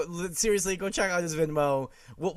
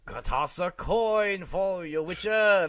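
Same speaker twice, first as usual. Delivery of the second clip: slow sing-song; 3 semitones higher, 3 dB louder, much slower than usual. Acted. Quoting the Witcher song.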